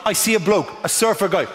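ordinary talk, nothing marked

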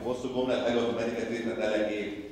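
ordinary talk

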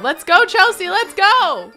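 high, loud rallying call; urgent cheering on. Pretend. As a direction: shout to hurry her along. Urging her on to the hospital.

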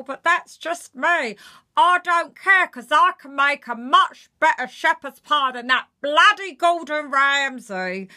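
shrill-free but loud miserable moaning; miserable Janet Street Porter. Shown as a demonstration. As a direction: play Janet Street Porter hating everything. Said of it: "So for Janet".

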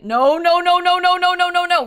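rapid string of high, loud no's; panicked. Real, not acted. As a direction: panicky rising stream of no's, held high. Enemies close in during the game.

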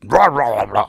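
loud mock savage dog sounds; dog mauling noises. Dog eats the robber's face, savage imitation. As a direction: imitate a dog tearing into someone.

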